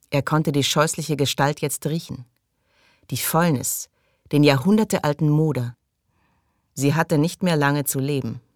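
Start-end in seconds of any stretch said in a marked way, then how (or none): none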